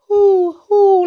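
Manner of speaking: high, slow hoots; owl hoot. A narrator's reading. Reader reviews the owl sound, high hoots. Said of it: "like an owl".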